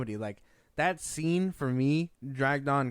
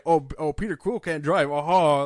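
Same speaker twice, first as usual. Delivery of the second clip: mocking; 8 dB louder than usual. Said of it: "it's followed by".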